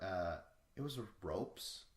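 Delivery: usual speaking voice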